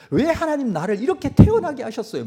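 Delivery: imitating a loud, high resentful cry to God; resentful complaint. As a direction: wail the grievance upward. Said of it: "원망할 때도 있어요".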